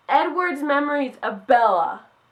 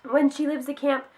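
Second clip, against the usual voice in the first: ordinary delivery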